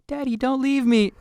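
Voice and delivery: put-on very high, loud child's plea; pleading child voice. Dad re-enacting the app's painful reminder, pleading child voice. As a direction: cry it out like a little kid. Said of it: "I'm laughing, but I'm, like, crying".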